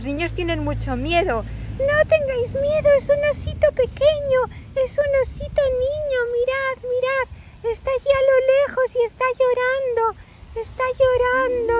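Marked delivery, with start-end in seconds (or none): 1.9-11.7 put-on very high, fast, loud childlike voice; little squirrel Ethan. Little squirrel telling scared children it's a baby bear, high fast squeal. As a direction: gabble it excitedly in a tiny squeak.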